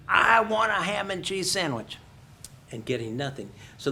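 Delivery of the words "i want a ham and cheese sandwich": higher and much louder, mock-shouting the same order; talking louder. Tourist repeating her order to the uncomprehending cashier, now much louder and higher. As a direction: blast the order again as if volume will make her understand. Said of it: "what you do is you talk louder".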